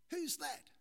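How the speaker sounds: high-pitched shouted question; calling up. Acted. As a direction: call up to an unseen stranger. Stranded man calls up.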